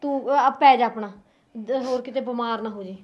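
mother gently comforting her crying daughter; soft comforting. Acted. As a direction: soothe her to lie down.

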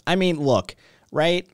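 ordinary delivery

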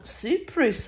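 "Cyprus" echoed as SEE-prees; Greek-style pronunciation. Retold or imitated. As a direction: repeat "Cyprus" with ee in both syllables. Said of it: "the person next to me yells out".

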